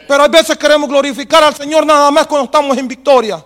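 loud, high, fervent preaching to the church; full-force preaching. Acted. Preacher exhorting the congregation, loud and fervent.

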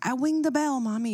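put-on high little kid voice, lisping wing; a small boy calling to mom from the wall; toddler voice. A two-year-old shouting to his mom from the top, high and lisping.

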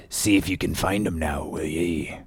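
low, hushed stranger's voice; soft, eerie aside. Acted. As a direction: creep up and murmur it secretively. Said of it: "he came softly after us".

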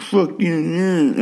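high, snotty teen mimicry; mocking bratty teen. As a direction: sneer it like a sulky teenager.

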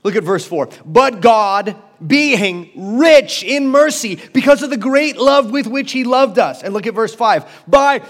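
loud, high-pitched sermon climax; impassioned pulpit proclamation; full-force preaching. Acted. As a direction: preach it out, building fervor.

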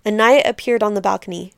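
ordinary delivery, nothing marked

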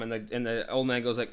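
normal talking voice

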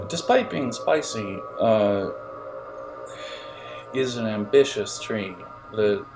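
ordinary delivery

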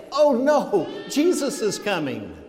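high, loud "oh no" of dismay; mock-panicked outcry, starting high and loud; mock alarm. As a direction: imitate someone caught in a panic, easing off after the cry. Voicing alarmed people on judgment day.

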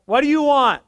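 acted hostile shout; gruff challenge. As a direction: snap it at an unwelcome visitor. Hard-faced woman confronts him, retold.

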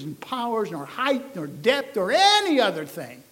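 loud, high, ringing "we know"; preaching at full force. Acted. Pastor proclaims scripture to the congregation, full-force preaching. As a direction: proclaim it to the back pew.

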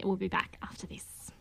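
whisper. Real, not acted. Host signs off to break.

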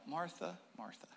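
soft, low and gentle Martha, Martha; quiet Jesus. Shown as a demonstration. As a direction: say the names barely above a breath. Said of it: "Except he was probably quiet".

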